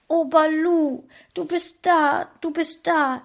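wife weeps with joy holding her dog; tearful joy. A narrator's reading. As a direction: hug the dog and cry with relief. Wife hugs returned dog, tears.

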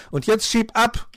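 high, loud brush-off; angry dismissal. A narrator's reading. Pechmarie tells fox to leave, defiant. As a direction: send him packing loudly. Girl sends fox away.

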